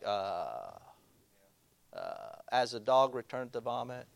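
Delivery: dazed reader. Retold or imitated. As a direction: sound blank and clueless. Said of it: "you may be like".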